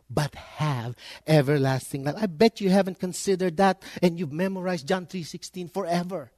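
usual speaking voice

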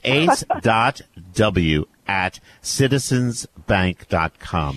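drawn-out, careful read-out of an address; slow, deliberate. Real, not acted. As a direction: dictate it word by word, slowly.

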